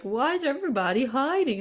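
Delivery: high, singsong puzzled question; innocent Dave asking. Retold or imitated. Little brother arriving at camp, innocently puzzled. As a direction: ask it high and wide-eyed.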